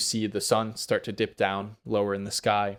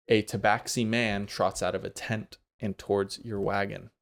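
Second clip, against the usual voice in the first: ordinary delivery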